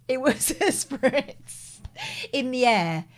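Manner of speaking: spritz drawn out slowly with a laugh. Shown as a demonstration. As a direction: laugh through a slow spritz.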